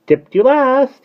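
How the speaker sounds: high, loud cheeky taunt; cheeky bird squeal. A narrator's reading. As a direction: crow it like a playground tease. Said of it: "he said cheekily".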